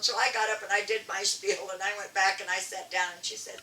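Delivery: usual speaking voice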